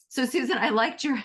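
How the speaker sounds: ordinary talk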